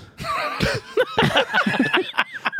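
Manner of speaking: high helpless laugh bursting out; burst of high laughter. Real, not acted. Comic laughing hard retelling a drunk audience member. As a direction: crack up at the memory.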